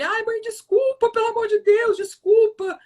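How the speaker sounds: very high-pitched retold begging; pleading apology. Boy to his mother at the principal's office, pleading.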